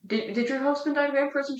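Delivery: high-pitched mock questioning of a widow; villagers questioning. Villagers' pointed question to widow, acted.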